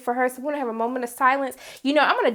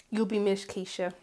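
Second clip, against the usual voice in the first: sigh, then a soft whisper; hushed mock-solemn tribute; whispered farewell; 4 semitones lower, 10 dB quieter than usual. Real, not acted. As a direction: whisper a goodbye during a moment of silence. Moment of silence for Keisha.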